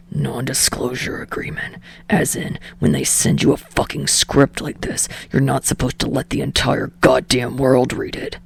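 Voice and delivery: low, breathless unvoiced whisper; whispered irritated boyfriend; whispered Bakugo. A narrator's reading. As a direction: hiss it out low like a secret.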